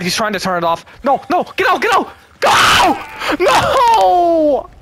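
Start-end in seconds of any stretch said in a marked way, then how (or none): 1.0-1.8 urgent rising plea; tense, higher, pushing them to flee; panicking gamer. Real, not acted. Teammate being hunted, urging. 1.8-2.1 high panicked shout; panicking gamer. Real, not acted. Teammate being hunted, urging. 2.4-4.7 panicking gamer. Real, not acted. Teammate caught, screaming.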